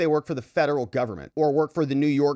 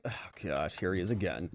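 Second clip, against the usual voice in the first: weary aside; 7 semitones lower, 8 dB quieter than usual. Real, not acted. Aside on the judge returning.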